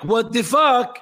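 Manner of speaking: high, loud what the fuck; shocked outburst. Retold or imitated. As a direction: blurt it out in shock. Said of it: "And Asuka's like".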